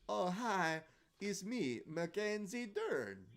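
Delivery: high, loud put-on foreign accent; exaggerated mock accent, slowing on the name; Mackenzie Dern. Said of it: "comes up with a new accent".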